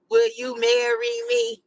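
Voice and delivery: high, slow pleading proposal; hammed-up squeaky proposal; mock proposal. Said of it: "a whole speech".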